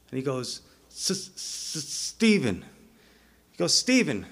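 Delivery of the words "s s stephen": halting, stuck S before the name; mock stammer, faint then released; imitated stutter. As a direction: stutter on the first sound, then push out the name. Acting man who needs speech healed.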